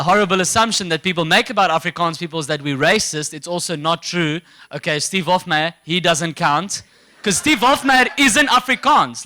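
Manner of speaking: ordinary talk, nothing marked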